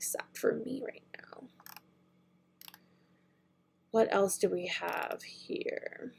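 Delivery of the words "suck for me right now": a disappointed whispered aside. Real, not acted. To viewers about the sold-out palettes, a sulky whisper.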